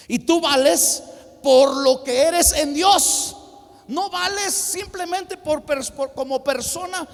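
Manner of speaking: loud, high, drawn-out proclamation; emphatic preaching. Real, not acted. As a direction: shout it out to the congregation. Preacher drives home key point.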